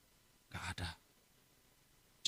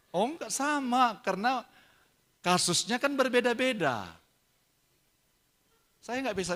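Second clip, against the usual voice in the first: much higher, drawn-out protest; 9 semitones higher, 3 dB louder than usual. Retold or imitated. Father answering child's charge of favouritism, high-pitched protest. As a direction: defend yourself in a high voice.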